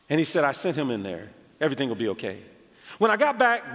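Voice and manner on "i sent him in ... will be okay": low, composed reassurance; imitated calm pastor. Zambian pastor reassuring the preacher about the boy, calm. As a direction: quietly reassure that it's handled. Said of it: "He seems so calm".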